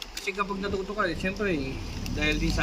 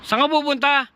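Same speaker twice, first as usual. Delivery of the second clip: loud call across the road; 9 semitones higher, 6 dB louder than usual. Real, not acted. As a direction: call out to someone ahead. Calling out to rider ahead.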